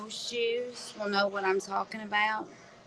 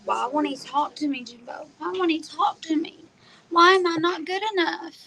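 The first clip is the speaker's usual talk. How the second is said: higher-pitched imitation of a plaintive complaint; mock plaintive caller; 6 semitones higher than usual. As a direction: mimic her pleading, higher in pitch. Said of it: "her saying".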